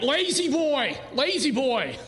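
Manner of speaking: lightly voiced women chiming in, a laugh on the second; playful mock call from the women in the room; lightly imitating eager women answering. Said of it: "The women are like".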